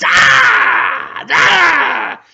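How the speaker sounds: high, loud attack cry; hammed-up stabbing shriek; threatening yell. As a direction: menace them with wild mock screams. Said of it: "points them ... threateningly and just goes".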